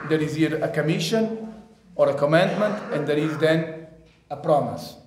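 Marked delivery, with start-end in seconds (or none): none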